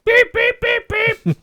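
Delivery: high loud angry beeping; angry droid beeps. Acted. R2 snaps back at 3PO, high angry beeps. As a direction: beep like a furious little robot. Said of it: "R two beeps angrily".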